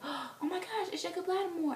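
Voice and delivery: high, starstruck fangirl gush; high-pitched retold fan excitement. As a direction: imitate a girl thrilled to spot her idol. Retold fan girl spotting a star.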